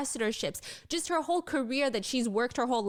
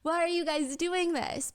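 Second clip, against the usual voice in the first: higher, playful mock scolding of the hosts; a light teasing protest, not truly upset; lighthearted complaint; 6 semitones higher than usual. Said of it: "very lighthearted".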